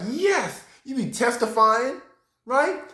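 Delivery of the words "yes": high-pitched celebratory "yes"; elated yes. Acted. Grateful believer receiving a franchise from God, elated. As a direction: exclaim it like a blessed believer. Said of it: "You'd be like".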